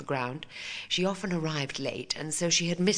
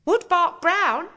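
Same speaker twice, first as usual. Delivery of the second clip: put-on much higher, louder, slow girlish answer; proud schoolgirl reply; eager young pupil; 11 dB louder, slower than usual.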